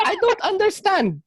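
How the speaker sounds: high, confused Filipino mom voice; puzzled mom in a Filipino accent. Retold or imitated. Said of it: "She's like".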